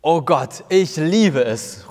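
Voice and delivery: an excited outcry, slowing on the last words; loud cry. Acted. Jesus cries out loudly on seeing the garden, joyful shout. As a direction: call it out with joy. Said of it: "ruft Jesus laut".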